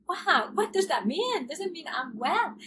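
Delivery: amazed it might really be over; retold disbelief at being well; astonished. Sudden realisation of recovery.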